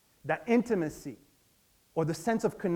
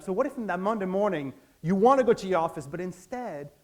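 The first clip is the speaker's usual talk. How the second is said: normal talking voice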